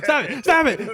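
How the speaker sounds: high, loud, laughing through it; giggling stop it at the table; laughing plea. Real, not acted. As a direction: beg them to stop while cracking up. GM laughing at players.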